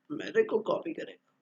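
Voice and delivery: a whispered, half-voiced grumble under the breath; a private whispered complaint. Real, not acted. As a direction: mutter it to yourself, nearly unvoiced. Grumbling aside about being copied.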